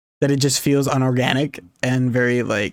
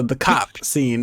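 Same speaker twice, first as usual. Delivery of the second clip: ordinary delivery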